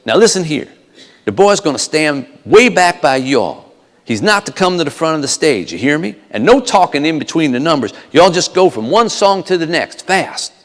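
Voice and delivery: bossy Southern manager laying down rules; Colonel Parker in Southern accent. Acted. Manager instructs the band after rehearsal, Southern orders. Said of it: "Colonel Parker says".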